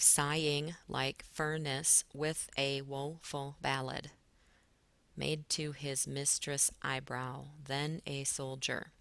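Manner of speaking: slow, syllable by syllable; slow reading to show the syllable breaks. Shown as a demonstration. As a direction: read each syllable out slowly and deliberately. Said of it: "I did that here with the dashes".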